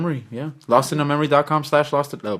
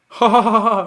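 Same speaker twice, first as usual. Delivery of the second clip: mock laugh track; 8 semitones higher, 5 dB louder than usual. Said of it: "laugh track".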